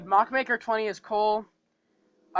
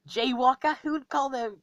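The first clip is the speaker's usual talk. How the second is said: high, louder, British-sounding quip; accidental British accent; 6 semitones higher, 5 dB louder than usual. Acted. Creator voicing Rocket's little joke, accidental British accent. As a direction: slip into a British accent, pitched up. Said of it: "I don't know why that sounded British".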